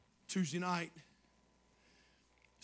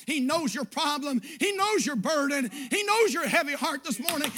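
louder, driving preacher's cadence; preaching at full force; 7 semitones higher, 6 dB louder than usual. Acted. Pastor assuring the congregation God knows them, in full preaching force. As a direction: pound each line home to the church. Building sermon climax.

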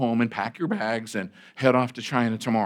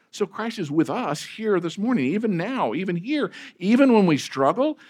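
higher, fervent preaching build; impassioned preaching; 7 semitones higher, 4 dB louder than usual. Acted. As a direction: preach it with rising conviction. Rising passionate preaching section.

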